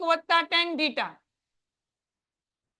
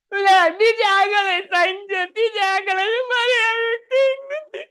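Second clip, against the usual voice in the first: hammed-up whimper, higher and slower; mock crying; 5 semitones higher, 4 dB louder, slower than usual. Teacher voices a crying term for students, mock sob. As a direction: whine like a child left out. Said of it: "એમ અહીંયા આ રોવા માંડ્યો".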